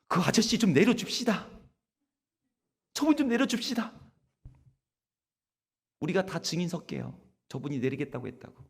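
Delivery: loud, high crowd plea to the driver; 승객들이 기사에게 함께 외치는 큰 소리; passengers shouting. Retold or imitated. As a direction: shout it together as the angry passengers. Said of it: "승객들이 함께 외쳤습니다".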